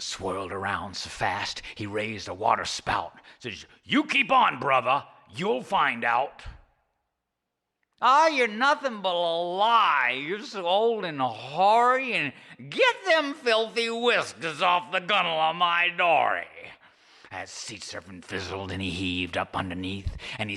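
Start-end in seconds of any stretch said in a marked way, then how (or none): 3.8-6.4 higher, loud warning; a touch of menace; lightly voiced menacing serpent. Serpent threatens Charlie. 8.0-16.5 put-on much higher, loud, scoffing old man; high cranky fisherman scoffing; sarcastic Charlie. Charlie insults the serpent.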